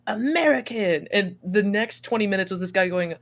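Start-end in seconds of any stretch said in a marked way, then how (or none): 0.1-1.1 slow, higher mimicry of a stranger; lightly mocked stranger, slowly spoken; imitated stranger. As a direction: draw it out like the man you're imitating. Imitates the man's reaction.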